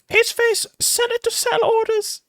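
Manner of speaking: very high, loud squealed shout; put-on shriek of outraged viewers, slow and drawn out; mock screaming. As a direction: imitate people screaming in a piercing high voice. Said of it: "People are gonna be screaming".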